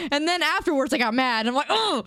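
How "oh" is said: frustrated. Retold or imitated. Frustrated outburst after ticket.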